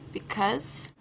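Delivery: ordinary talk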